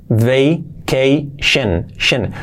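very slow "Vacation": vay, kay, shun; syllable-by-syllable demo. Shown as a demonstration. Teacher breaking the word down for students, syllable by syllable. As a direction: split "Vacation" into syllables with long gaps.

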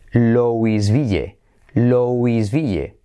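LOUISVILLE drawn out slowly as "lo-is-BI-ye"; spell-read LOUISVILLE slowly in Spanish sounds. Shown as a demonstration. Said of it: "Y se escribe".

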